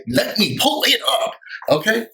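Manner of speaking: high, sassy catchphrase. Shown as a demonstration. Host closing her review with her catchphrase, sassy. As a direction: throw it out with attitude. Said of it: "that's my new thing".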